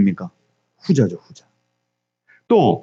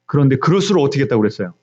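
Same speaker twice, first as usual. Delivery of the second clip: normal talking voice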